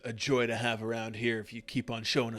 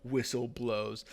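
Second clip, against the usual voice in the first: ordinary talk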